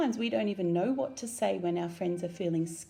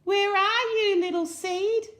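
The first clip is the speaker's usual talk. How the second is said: high, loud searching call; 10 semitones higher, 5 dB louder than usual. A narrator's reading. Little Fox searches for Seed, calling high and loud. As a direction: call for a friend you can't find.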